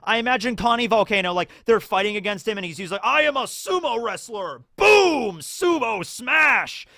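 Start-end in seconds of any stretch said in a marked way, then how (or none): 3.0-4.6 higher mock boast; sumo villain. Villain voiced in fight retelling. 4.8-6.9 much higher, loud, stretched battle cry; mock attack shout. Villain attack voiced.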